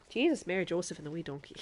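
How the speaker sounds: hammed-up Irish oath, punched at the start; comic put-on Irish accent; Irish exclamation. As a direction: exclaim it in a mock-Irish brogue. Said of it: "very good phrase".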